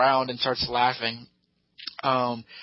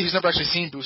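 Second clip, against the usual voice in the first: normal talking voice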